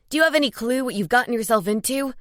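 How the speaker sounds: fast, urgent panicked plea; words tumbling out in fear; rising panic. A narrator's reading. Marcos panicking at his sister, fast and rising. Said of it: "the panic in his voice rising".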